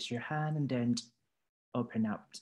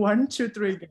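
energetic countdown; 7 semitones higher, 5 dB louder, slower than usual. Real, not acted. Teacher cues student to start.

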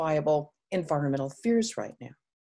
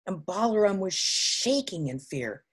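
ordinary delivery

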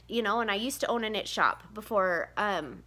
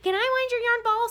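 put-on kids asking; 12 semitones higher than usual. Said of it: "and they're like".

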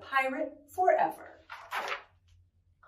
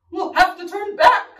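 loud, raised call; 7 semitones higher, 6 dB louder than usual. Acted. Another pirate answers in the storm, calling out. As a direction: call it across the deck. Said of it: "called another".

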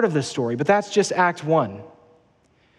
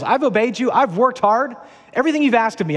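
higher-pitched aggrieved listing of his merits; indignant older son; 6 semitones higher than usual. Retold or imitated. Older son protesting to his father, higher and indignant. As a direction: rattle off your merits, wounded and raised. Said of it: "he lists his credentials".

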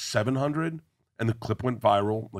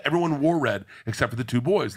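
usual speaking voice

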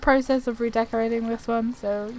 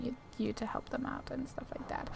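whisper; 15 dB quieter, faster than usual. Real, not acted. Gamer whispering to viewers mid-commentary. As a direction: drop to a whisper.

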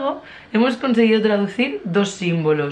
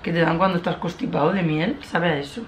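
talking while sucking candy; candy in mouth. Real, not acted. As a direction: lips pursed around sweet. Seen 3: Lips pursed, rolling candy in mouth, finger at lips while speaking.

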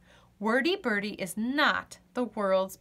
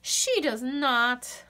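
usual speaking voice

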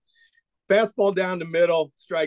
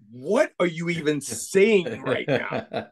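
slow, drawn-out retold bewilderment; incredulous wife; slower than usual. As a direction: imitate a baffled wife, stretching the words. Wife reacts to sudden moving news.

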